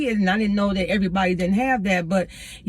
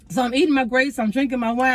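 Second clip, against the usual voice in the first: usual speaking voice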